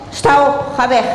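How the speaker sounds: high, sharp dismissal; snapped order. Acted. Amnon drives Tamar away with a sharp high snap. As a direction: bite the words off at her. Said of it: "beet hij haar toe".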